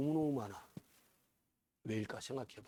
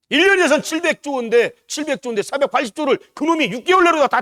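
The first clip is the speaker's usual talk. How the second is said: high, loud outraged sermon; fiery preaching; 9 semitones higher, 12 dB louder than usual. Real, not acted. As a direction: thunder against the robbery from the pulpit. Preaching at full force.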